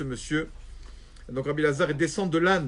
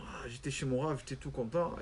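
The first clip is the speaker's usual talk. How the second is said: quiet contented musing; 4 semitones lower, 8 dB quieter than usual. Acted. Rabbi Elazar's inner contented musing.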